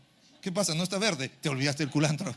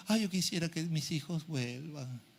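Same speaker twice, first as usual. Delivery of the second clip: acted parent's lament; 3 semitones lower, 9 dB quieter than usual. Voices a tearful, wishing parent.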